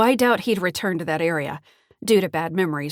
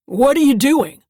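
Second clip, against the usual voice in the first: much louder and higher, booming at her; 7 semitones higher, 10 dB louder than usual. A narrator's reading. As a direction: catch her hand and boom the question. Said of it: "He says in a booming voice".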